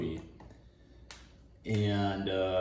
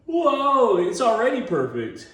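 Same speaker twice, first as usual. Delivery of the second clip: high, loud mock amazement; mock surprise; 11 semitones higher, 4 dB louder than usual. Customer gushing at the cherry, mock surprise. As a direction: gasp in fake awe. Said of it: "And then you're like".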